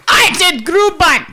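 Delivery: slow mock-German shout; drawn-out comic German bellow; German accent. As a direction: echo it as a German yell. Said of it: "What's the German?".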